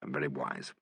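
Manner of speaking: low, quiet and solemn; grave. A narrator's reading. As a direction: keep it grave and soft. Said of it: "said Alan gravely".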